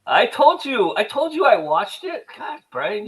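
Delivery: high-pitched, loud indignant comeback; exasperated protest. Real, not acted. Co-host insists to Brian he already told him, exasperated protest. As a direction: protest your innocence, pitch way up.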